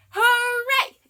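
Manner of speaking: high, loud cheer of hooray; shouted hooray. Acted. Teacher cheering hooray with the class. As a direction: cheer it out to the kids. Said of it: "shout hooray".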